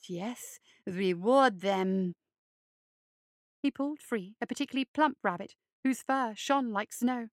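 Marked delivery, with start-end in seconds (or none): 0.1-2.1 low, slow, creepy hare voice; low creeping monster croon; hulking hare creature. Acted. Luther continues.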